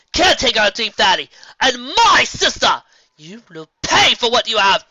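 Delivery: loud, high, slowing furious shout; enraged tirade. A narrator's reading. Nightmare Moon rages at the filly, loud tearful fury. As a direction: rage and grief together.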